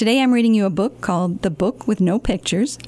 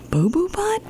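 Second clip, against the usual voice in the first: Boo Boo Butt much higher, rising, disbelieving; incredulous question; 8 semitones higher than usual. Acted. Librarian can't believe the hippo's name, high rising Boo Boo Butt. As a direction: repeat Boo Boo Butt in shock.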